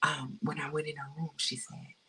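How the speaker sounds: ordinary delivery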